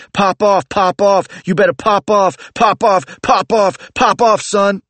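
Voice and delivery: high, loud street-tough taunt, over and over. Acted. Bro charging up the street yelling at narrator, high loud taunt. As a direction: shout the challenge like a bro itching to fight.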